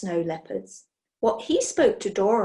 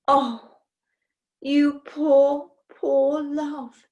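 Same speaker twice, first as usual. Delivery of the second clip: high, slow cooing over a sick pet; tender pity; 5 semitones higher, 4 dB louder, much slower than usual. Acted. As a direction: pour pity on the poor creature. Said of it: "cried Heki".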